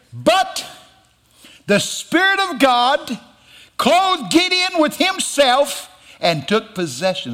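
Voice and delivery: high, slow, ringing scripture proclamation; full-force preaching. Acted. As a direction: draw each phrase out high and emphatic. Preaches the key verse at full force.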